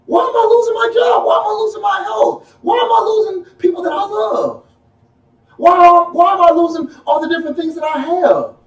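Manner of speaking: rising into high pleading cries; from puzzled complaint up to high pleading wails; mock self pity. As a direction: act the wailing sinner who feels wronged. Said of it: "You know how we get".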